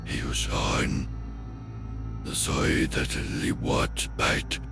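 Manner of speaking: ordinary delivery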